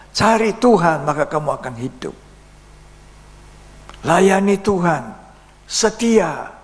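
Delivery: high, loud exhortation to the faithful; impassioned preaching. Real, not acted. Preacher urging congregation to seek and serve God, impassioned preaching. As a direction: drive each line home at full preaching force.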